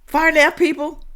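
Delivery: high, loaded mock question; sly provocative jab; teasing. Real, not acted. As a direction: say it like a jab you pretend to regret. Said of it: "don't let me say far left".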